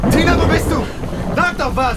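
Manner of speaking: loud, high call for her; shouting. Acted. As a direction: yell across the dark. Calling in the dark.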